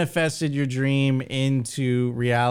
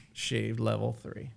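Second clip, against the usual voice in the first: relaxed; 6 semitones lower, 11 dB quieter than usual. Pretend. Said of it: "My level of relaxation".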